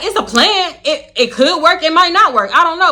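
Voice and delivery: high excited retelling of her own doubt; giddy quoting. Retold or imitated. Telling the friend the plan might work, high and giddy. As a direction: chirp it out, half laughing.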